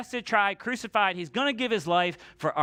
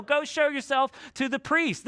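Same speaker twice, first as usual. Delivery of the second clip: yelling back; 6 semitones higher than usual. Acted. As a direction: repeat it pitched up for someone who misheard. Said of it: "he's yelling back".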